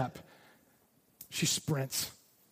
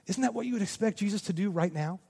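ordinary talk

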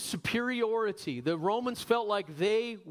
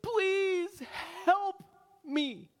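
high, strained cry, word by word; imitating a desperate mother begging; acted desperate plea; 12 semitones higher, much slower than usual. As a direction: cry out each word, pausing between them. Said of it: "She's saying".

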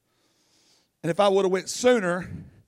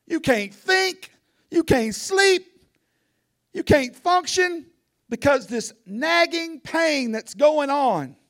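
high, loud, slow preacher's cry, word by word; preaching at full force; 9 semitones higher, 5 dB louder, slower than usual. Acted. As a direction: hammer each can't home to the congregation. Preacher building to climax.

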